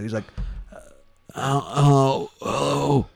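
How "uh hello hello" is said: weak, groaning sick hello; sick Walt. Retold or imitated. Co-host imitating his sick friend answering the phone, groaning impression. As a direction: moan each hello as if ill. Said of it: "let me see if I can do Walt".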